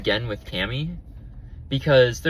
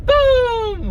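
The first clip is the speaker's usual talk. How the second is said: a sudden very high, loud "Boom!"; playful shouted boom; imitating the cat's boom; 6 dB louder than usual. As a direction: blurt it out like a startled cat. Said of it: "the Mister Boom cat who said boom".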